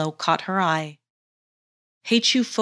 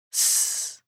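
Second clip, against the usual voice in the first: snake hiss; 6 dB quieter than usual. Acted. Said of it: "Caw continued".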